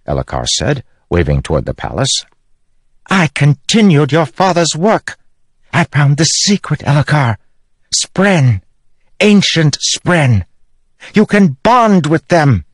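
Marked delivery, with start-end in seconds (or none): 3.1-12.6 high, slow triumphant reveal; queen proudly revealing the spren bond; gloating queen. Acted. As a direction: relish the secret.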